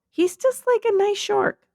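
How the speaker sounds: squeaky cute voice. Real, not acted. Defending the shark to Dan, high sweet pleading voice.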